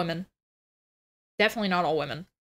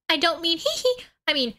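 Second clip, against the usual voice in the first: normal talking voice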